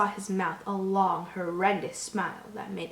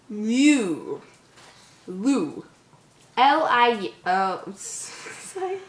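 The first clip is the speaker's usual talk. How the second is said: ordinary delivery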